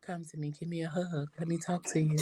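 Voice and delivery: very soft, soothing invitation; warming voice. Retold or imitated. Imitating co-host's voice as a hug, soft and warming. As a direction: imitate a comforting voice, hushed and gentle. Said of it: "It's real warming".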